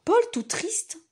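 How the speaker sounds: ordinary delivery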